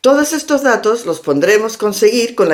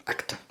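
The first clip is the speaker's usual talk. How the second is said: ACTOR stressed on its first syllable, the second weak and short; 20 dB quieter than usual. Shown as a demonstration. Teacher shows learners where English puts the stress, "actor" stressed on first syllable. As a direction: say "actor" the English way, punch on the opening A. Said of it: "en inglés la primera".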